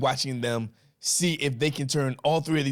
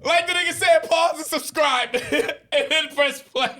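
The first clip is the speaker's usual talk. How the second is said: laughing; 14 semitones higher, 4 dB louder than usual. Real, not acted. Laughing retelling of friend's joke.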